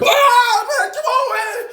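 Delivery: high shouting call, laughing; shouted high plea; mock yell. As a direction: yell it out high like a guy calling across. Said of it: "we heard".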